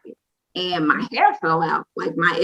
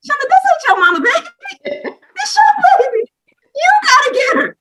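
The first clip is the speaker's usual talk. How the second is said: very high, loud squealed realization; self-scolding outburst; 6 dB louder than usual. Retold or imitated. New mom telling herself it's her baby, shrieked self-scolding. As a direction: shriek it at yourself with a laugh in it.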